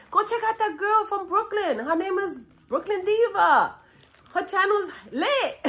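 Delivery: high-pitched hype of an imagined fan; hyped fan talk. Pretend. Imagined fan recommending her channel to friends, hyped. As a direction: play a fan raving about a channel. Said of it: "My kids hate when I talk like this".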